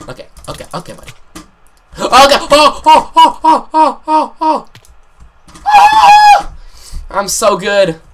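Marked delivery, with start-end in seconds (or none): 2.0-6.5 repeated high, loud ohs climbing into a shriek; panicked yelps and scream. Real, not acted. Player in a frantic game moment, yelping into a scream. 7.1-8.0 high, loud gloating; triumphant boast. Real, not acted. Player right after a frantic game moment, triumphant shout.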